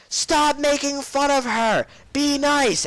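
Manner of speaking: high, slow mock teacher screech; hammed-up high-pitched telling-off; scolding teacher. Art teacher strolls up on kids joking, mock scolding screech.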